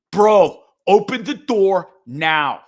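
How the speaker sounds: loud, slow pounding-on-the-door demand; furious order, each word dragged out; angry demand. Retold or imitated. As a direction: bark it at the neighbour through the door. Threatening the neighbour at his door.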